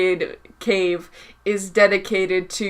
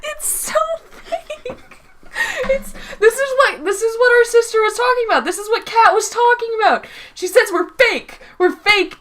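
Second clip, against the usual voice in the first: high, squealing through laughter; real laughing protest; 11 semitones higher than usual. Real, not acted. Calling out a sibling's fake voice, high laughing protest. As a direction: protest while cracking up, pitched way up.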